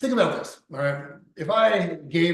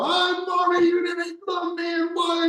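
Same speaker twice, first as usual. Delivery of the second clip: high, shouted whiny wail of a grown man calling for mommy; imitating a man screaming at his mom next door; mock wailing child; 4 dB louder, slower than usual. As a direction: wail it like a hurt little boy. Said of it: "I'm hearing this guy go".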